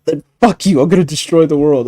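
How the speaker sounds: high, loud petulant villain; mock villain tantrum. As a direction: throw a bratty villain tantrum. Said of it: "Literally was like".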